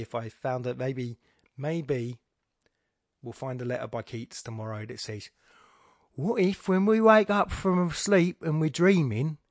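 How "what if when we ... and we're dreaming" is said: high, loud put-on Cockney; Cockney accent. Speaker imagining a letter by Keats, in a Cockney accent. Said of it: "doing a Cockney accent".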